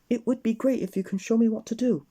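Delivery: high, keen acceptance; eager Mister Tickle. Acted. Mister Tickle accepting help, high and eager. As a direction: jump at the offer. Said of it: "said Mister Tickle".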